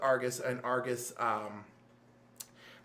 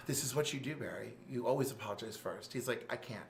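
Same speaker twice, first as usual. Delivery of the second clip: much softer, low, defeated retelling; weary resignation; 6 semitones lower, 8 dB quieter than usual. Retold or imitated. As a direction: let it sink, tired and quiet. After a sigh, weary resigned exchange.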